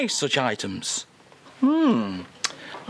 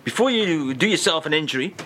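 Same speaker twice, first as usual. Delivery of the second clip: usual speaking voice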